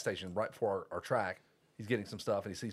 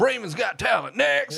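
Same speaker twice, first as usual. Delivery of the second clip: high, loud dismissive announcement; talent show judge; 10 semitones higher, 10 dB louder, slower than usual. Acted. Mocking dad's gate as an audition, loud judge voice. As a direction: shout it like a judge rejecting an act. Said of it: "It's like an audition".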